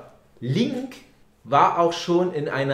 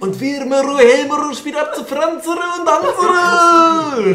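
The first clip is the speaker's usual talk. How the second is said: mock Japanese-style names; 13 semitones higher, 8 dB louder than usual. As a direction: belt out the silly names like a stadium announcer. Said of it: "Wir heißen ja auch alle Franz. Für die Japaner".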